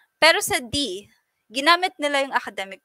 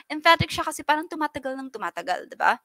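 ordinary talk, nothing marked

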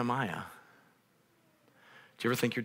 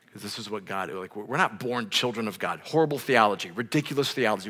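usual speaking voice

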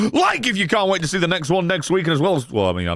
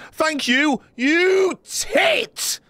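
much higher, cheeky yell; affectionate insult shout; 11 semitones higher, much slower than usual. Real, not acted. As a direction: bellow the insult fondly. Teasing donor thanks.

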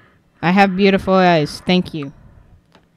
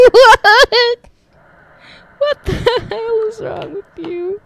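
high, slow incredulous cry; shocked disbelief; 10 semitones higher, 5 dB louder, slower than usual. Real, not acted. Gamer asking the owl what's wrong, high and incredulous. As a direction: stretch out the shock.

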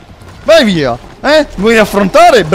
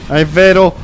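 usual speaking voice

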